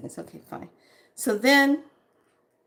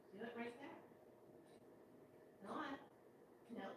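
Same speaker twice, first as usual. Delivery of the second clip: hushed, gentle urging to the cat; quietly coaxing the cat to cough it up; soft coaxing; 30 dB quieter, faster than usual. Real, not acted. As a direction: murmur encouragement to a struggling pet. Coaxing the cat through a hairball.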